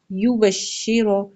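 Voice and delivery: یو بشیرو slowly as "yu-bash-shi-ro"; slow, syllable-by-syllable یو بشیرو; correct reading. Shown as a demonstration. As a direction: give یو بشیرو a close i and heavy final ro. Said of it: "شین کے نیچے زیر ہے".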